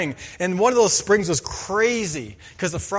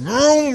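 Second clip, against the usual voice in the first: engine vroom; 8 semitones higher, 6 dB louder than usual. Retold or imitated. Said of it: "he was mad".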